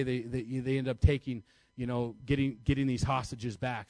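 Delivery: normal talking voice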